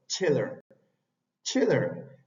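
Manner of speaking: chiller with a crisp ch; English chiller. Shown as a demonstration. Teacher modelling chiller. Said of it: "tenemos chiller".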